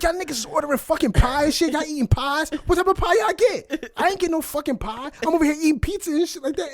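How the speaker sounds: high, shouting outrage; furious rant. Retold or imitated. Dad ranting at family about pie, furious high shout. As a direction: rage at everyone eating pie. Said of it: "he's angry as shit".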